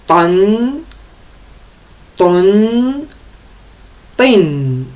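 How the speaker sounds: Tan, ton, tin each said with a clear nasal n ending; three tanwin sounds. Shown as a demonstration. Teacher models tanwin endings for learners, clearly. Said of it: "Deux fatha, deux dhamma, deux kasra".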